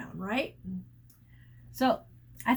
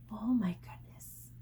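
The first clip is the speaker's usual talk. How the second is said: whisper; 3 semitones lower, 8 dB quieter than usual. Real, not acted. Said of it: "reacting to story".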